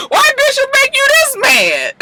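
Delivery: very high, through real laughter; helpless high-pitched amusement; laughing squeal. Real, not acted. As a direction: squeal it while cracking up. Cracking up over character's anger.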